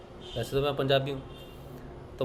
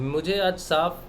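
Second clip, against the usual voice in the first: normal talking voice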